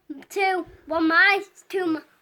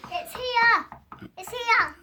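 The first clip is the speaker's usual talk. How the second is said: excited calling out; 7 semitones higher than usual. Real, not acted.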